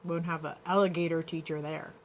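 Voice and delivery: ordinary talk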